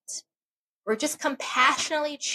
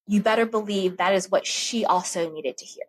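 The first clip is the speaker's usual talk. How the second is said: ordinary talk, nothing marked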